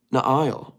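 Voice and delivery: "Nail" said as nuh-EYE-ul; three-syllable pronunciation. Shown as a demonstration. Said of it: "It's three syllables?".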